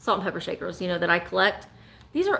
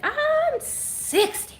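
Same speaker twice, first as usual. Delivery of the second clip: much higher, very slow put-on voice; SNL bit, sixty version; much slower than usual. Host spinning the SNL age joke to sixty, high slow mock boast. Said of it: "You can change it".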